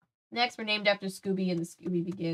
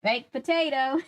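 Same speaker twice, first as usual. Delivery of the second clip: higher, badly faked English accent; really bad accent; 7 semitones higher, 4 dB louder than usual. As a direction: do a botched English takeaway-order accent. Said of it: "This is, like, really bad accent".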